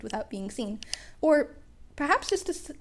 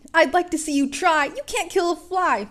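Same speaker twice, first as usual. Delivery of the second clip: taunting; 6 semitones higher, 7 dB louder than usual. A narrator's reading. Midoriya taunts the villain to draw its attack. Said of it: "Midoriya taunted".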